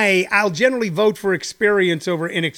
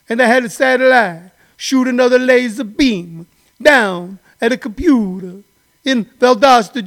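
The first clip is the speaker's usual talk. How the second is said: hammed-up Italian-American accent, raised and louder; mock Italian-American; 2 semitones higher, 3 dB louder than usual. Italian guy scolding Donald Trump, hammed-up Italian-American accent.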